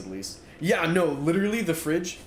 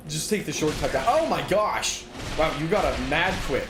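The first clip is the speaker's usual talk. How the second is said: ordinary talk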